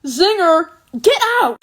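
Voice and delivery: high, very loud mock heckle; exaggerated loud jeer; mock outburst. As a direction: shout it high, hammed-up. Mock outburst at a lame joke.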